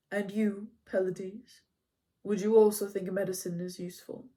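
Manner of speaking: lightly voiced wise centaur; Chiron's voice. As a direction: speak as the calm old centaur. Chiron turns to Achilles.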